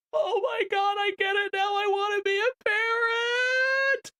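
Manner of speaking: high squealing, slow gushing mock fan; gushing new-parent fan. Mocking gamers overwhelmed by a game, high squealing gush. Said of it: "immediately go".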